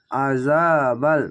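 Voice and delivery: আজাবাল very slow, with জা held for one beat; one alif stretch. Shown as a demonstration. Teacher showing the stretch in আজাবাল, slow recitation. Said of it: "এক আলিফ টেনে পড়বেন".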